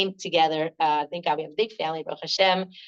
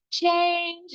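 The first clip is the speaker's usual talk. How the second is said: imitated high call; 10 semitones higher than usual. Daughter calls to her upset mother from the kitchen, high call. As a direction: lightly imitate a child calling from another room.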